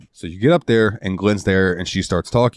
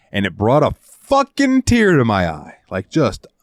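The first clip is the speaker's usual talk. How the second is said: ordinary talk, nothing marked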